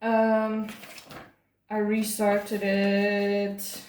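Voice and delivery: very slow, drawn-out words; stalling. Real, not acted. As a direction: stretch each word while searching your notes. Stalling while looking up the date.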